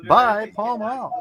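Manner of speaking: put-on peppy old-time commercial pitch; chipper 1950s ad. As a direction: sell it like a vintage ad man. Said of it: "Kiko Man ... says".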